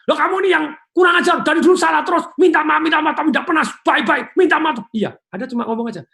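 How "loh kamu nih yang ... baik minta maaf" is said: loud, high angry shouting; furious shout. Retold or imitated. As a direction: yell at your spouse in a rage. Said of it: "pasangan malah ngebus, ngegas". (teriakan marah yang keras)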